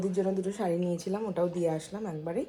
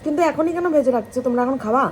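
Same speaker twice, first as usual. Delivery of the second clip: calling out; 5 semitones higher, 7 dB louder than usual. Real, not acted. Calling to family in kitchen.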